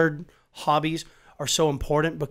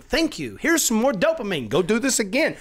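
much higher, louder, bright voice of a thankful body; grateful body voice; 7 semitones higher, 4 dB louder than usual. Pretend. His body thanking him after the hike, high and cheerful. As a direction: play your body cheerfully thanking you.

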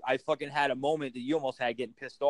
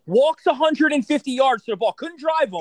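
incredulous; 9 semitones higher, 6 dB louder than usual. Real, not acted. Incredulous retelling of old golfer.

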